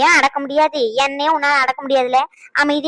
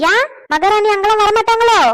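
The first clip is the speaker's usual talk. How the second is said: mocking scold; 7 semitones higher, 5 dB louder than usual. Mother-in-law mocks the daughter-in-law.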